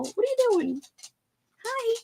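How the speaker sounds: high-pitched doggy talk; talking to a pet. Real, not acted. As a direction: coo at the dog in a high little voice. Talking to her dog.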